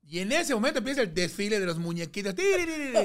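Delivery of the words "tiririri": high, loud sung fanfare; parade tune hummed. Retold or imitated. Narrator humming the parade music bursting in. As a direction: imitate the parade jingle.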